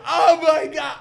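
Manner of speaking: high, loud, laughing cry of disgust; disgusted shout. Retold or imitated. Kids in class reacting to the girl's sneeze, shrieking. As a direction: shriek it like kids seeing something gross. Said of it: "And we're like".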